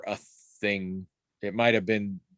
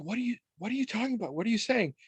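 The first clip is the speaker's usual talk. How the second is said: puzzled; 11 semitones higher, 4 dB quieter, faster than usual. Retold or imitated. Teller retells puzzled reply.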